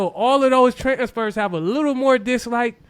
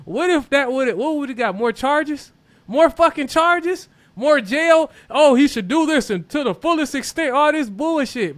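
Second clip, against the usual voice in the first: raised, high-pitched exasperation; indignant rant; 6 semitones higher, 4 dB louder than usual. Real, not acted. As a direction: rant in rising outrage at the unfairness. Ranting about consequences of the scuffle.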